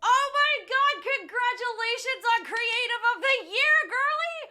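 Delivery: high, loud gushing; excited congratulations. Real, not acted. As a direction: gush over the win. Congratulating a friend on the award.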